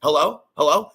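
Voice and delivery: ordinary talk, nothing marked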